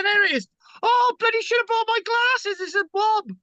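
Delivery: high squeaky gawking crowd voices; mock excited onlookers. Lecturer mimics sightseers squinting at a distant ship, high-pitched. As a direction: squeal like a crowd craning to see a wreck. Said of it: "They're all going".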